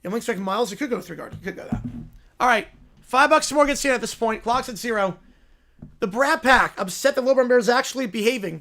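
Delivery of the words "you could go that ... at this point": ordinary talk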